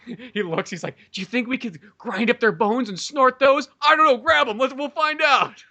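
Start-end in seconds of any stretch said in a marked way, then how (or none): 1.1-5.6 high, mounting mock eagerness; crazed scheming. Playing addled Alan plotting to snort bones, crazed scheming. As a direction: build to a high, eager pitch.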